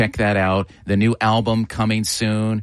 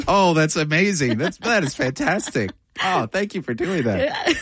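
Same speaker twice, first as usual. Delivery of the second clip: delighted; 7 semitones higher than usual. Real, not acted. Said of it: "having fun with voices".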